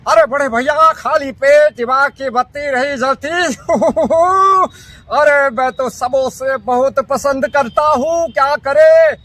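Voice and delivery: high, loud cartoon voice; cartoon character voice. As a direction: bounce it like a Motu Patlu cartoon. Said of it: "Motu Patlu ... झलकियां प्रस्तुत करूंगा".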